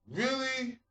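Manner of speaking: high disbelieving really; incredulous. Real, not acted. Vlogger reacting to friend's parents arguing, high incredulous. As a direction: pitch it way up in disbelief.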